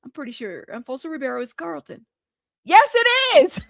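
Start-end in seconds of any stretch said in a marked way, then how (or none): 2.6-3.6 amused disbelief. Real, not acted. Confirms her startled guess, breaking up.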